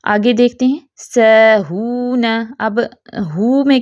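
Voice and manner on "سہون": "سہون" recited slowly with a long held "oo"; waw elongation. Shown as a demonstration. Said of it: "واو پر آواز کب کھنچتی ہے". ("سہون" کی واو لمبی کھینچ کر)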